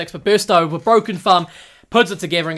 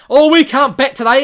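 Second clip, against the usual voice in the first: higher, louder mock whine of a hopeless batsman; mocking the batsmen; 8 semitones higher, 8 dB louder than usual. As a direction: ham up a batsman who gives up. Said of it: "Australia batsman like".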